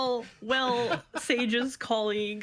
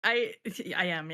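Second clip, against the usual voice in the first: usual speaking voice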